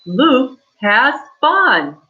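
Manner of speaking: bright, slow excited reading. Shown as a demonstration. Teacher models the exclamation mark, excited. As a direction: read it like it's thrilling. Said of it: "So we say excited".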